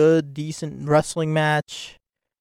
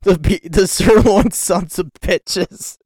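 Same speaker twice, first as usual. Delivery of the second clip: laughing quote; 5 semitones higher, 7 dB louder, slower than usual. Retold or imitated. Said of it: "as Sean Ross Sapp likes to call 'em".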